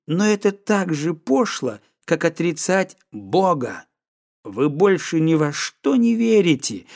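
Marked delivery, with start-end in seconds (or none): none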